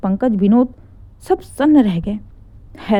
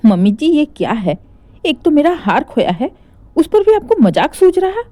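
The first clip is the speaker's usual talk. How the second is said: rising much higher and louder in protest; indignant outburst; 6 semitones higher, 3 dB louder than usual. Acted. Daughter-in-law protesting the mother-in-law's joke, indignant. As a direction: cry out, offended and shocked.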